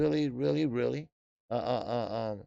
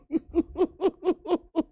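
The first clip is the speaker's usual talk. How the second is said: high-pitched laugh; 14 semitones higher than usual. Real, not acted. Laughing at big ass shoe.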